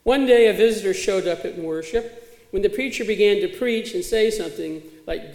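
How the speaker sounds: ordinary delivery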